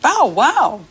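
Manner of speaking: high playful bow wow; puppy bark for a child; dog barking. Shown as a demonstration. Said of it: "what does the doggy say".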